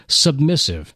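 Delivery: ordinary delivery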